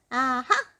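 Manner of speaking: high, loud goblin aha; smiling goblin. A narrator's reading. Goblin smiling at Mister Uppity's objection, high and loud. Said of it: "smiled the goblin".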